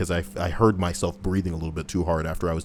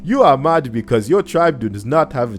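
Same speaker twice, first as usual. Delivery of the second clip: exaggerated African accent with a mock regal air; mock African accent, joking king voice; put-on African accent; 3 semitones higher than usual. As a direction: speak as a pompous African king. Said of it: "your people's accent that I'm using".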